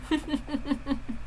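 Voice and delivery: breathy villain chuckle; creepy soft laugh. Acted. Villain mocking the girl, creepy soft laugh. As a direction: laugh quietly at her pain.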